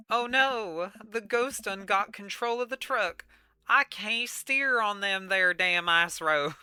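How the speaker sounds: put-on panicked trucker in a thick country accent. Ghost trucker loses control on ice roads, country accent. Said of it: "if this was my country accent".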